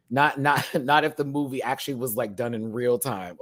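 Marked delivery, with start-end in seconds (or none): none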